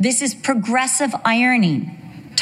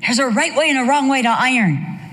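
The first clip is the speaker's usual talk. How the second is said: incredulous exclaim; 6 semitones higher than usual. Retold or imitated. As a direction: burst out at the friend's ironing. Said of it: "I'm like".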